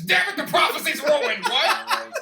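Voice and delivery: loud, high laughing shout; frustrated shout. Retold or imitated. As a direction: blurt it out in mock frustration. Acted Vince outburst, laughing.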